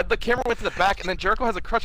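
ordinary talk, nothing marked

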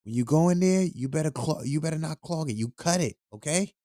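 grandma scolding about the clogged toilet, high voice. Retold or imitated. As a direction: mimic a nagging grandma. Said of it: "my grandma would tell me".

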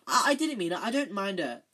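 high, loud, flustered denial; frantic. A narrator's reading. Hermione covering herself before Myrtle arrives, frantic. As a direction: stammer out a frantic excuse. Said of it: "Hermione whispered frantically".

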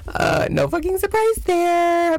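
high, mocking laugh then quip; sarcastic squeal. Real, not acted. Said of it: "sarcastic jab at police".

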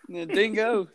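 slow, drawn-out Australian "The dingo."; Australian impression. Answering the dingo joke in mock Australian accent. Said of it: "awesome impression".

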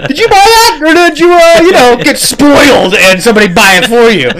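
high, loud, laughing taunt across lanes; laughing yell. Retold or imitated. Taunting the spoiled lady at the light, laughing yell. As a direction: yell it at her through the window, cracking up. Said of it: "I'm gonna fucking yell".

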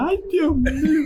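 mucho más agudo, entre risas, asustado en broma; susto fingido. Retold or imitated. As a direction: exagera el miedo con una risita. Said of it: "Y yo decía". (ante los palazos del equipo, susto burlón y agudo)

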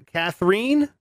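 Catherine said as "kath-REEN", rising high; unsure pronunciation. Real, not acted. Host puzzling over a listener's spelling, Catherine as "kath-reen", unsure. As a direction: try Catherine as a question, with a long "ee" ending. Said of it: "it's spelled all crazy".

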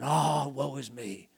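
slow, sighing mock lament; a hint of melodramatic woe; mock self-pity. Preacher imitating a self-pitying complainer, slow mock sigh. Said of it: "I complain".

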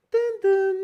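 high, loud imitated airplane chime; chime imitation. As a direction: mimic the cabin bell ding-dong. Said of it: "suena como". (imitando la campanita del avión, agudo y fuerte)

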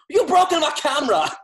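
high, loud furious shout; angry yell quote. Retold or imitated. Friend quotes Lars yelling about his broken camera, loud and high. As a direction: yell it like your camera just got wrecked. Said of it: "He's like".

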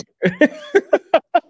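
high, loud run of laughter; real laugh. Real, not acted. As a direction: keep cracking up at the joke. Still laughing at the pun.

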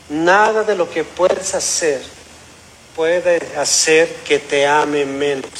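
slow, measured words from a father; tender fatherly reassurance. Retold or imitated. Father reassuring his son, retold. (consuelo paterno, lento y sereno)

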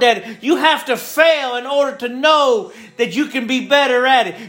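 high, driving sermon build; preaching at full force. Acted. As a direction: preach it up to the peak. Preaching climax, higher pitch.

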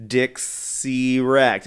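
very slow "Dixie Wrecked", stretched out; patient, slow repeat of "Dixie Wrecked"; said slowly again. Shown as a demonstration. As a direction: drag out "Dixie Wrecked" so the pun lands. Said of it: "had to say it again".

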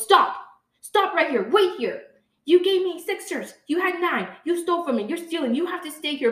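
loud, high, furious shouting at a suspect; angry yelling. Retold or imitated. Store clerk yells at a shopper he accuses of stealing, loud and furious. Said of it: "yelled at before".

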